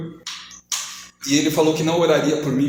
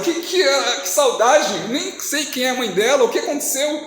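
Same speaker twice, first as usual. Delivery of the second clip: higher-pitched, stammering bewilderment; baffled protest; 7 semitones higher than usual. Retold or imitated. Preacher questioning God about an unknown mother, baffled and high. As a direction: question it in high, comic confusion. Acts out his confusion to God.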